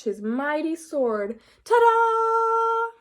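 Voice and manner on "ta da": much higher, louder drawn-out fanfare; triumphant ta-da. Acted. Froggy showing off his prince costume, loud triumphant ta-da. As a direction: sing out a grand reveal.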